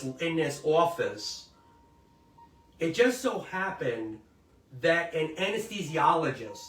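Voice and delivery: ordinary talk, nothing marked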